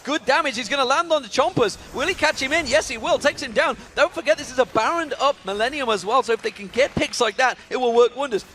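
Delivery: high, urgent live kill call; excited play-by-play. Real, not acted. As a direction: exclaim each move as it lands, pitch way up.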